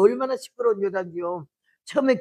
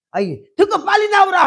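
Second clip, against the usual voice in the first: shrieking reply; 9 semitones higher, 7 dB louder than usual. Retold or imitated. Wife yelling reply.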